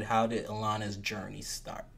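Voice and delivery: hushed dramatic whisper. Acted. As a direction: whisper it like a secret reveal. Host teasing guest's origin story.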